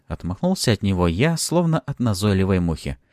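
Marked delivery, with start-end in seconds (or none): none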